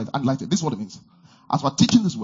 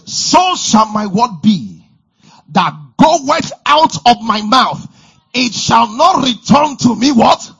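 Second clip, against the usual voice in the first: loud, high, rousing preacher voice; preaching at full force; 8 semitones higher, 7 dB louder, slower than usual. Acted. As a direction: drive it home to the congregation.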